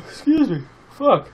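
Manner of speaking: talking through a big yawn. Real, not acted. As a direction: yawn breaking into the words. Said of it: "I don't know why I'm yawning so much".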